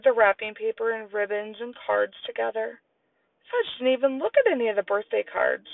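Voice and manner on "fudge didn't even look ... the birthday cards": ordinary delivery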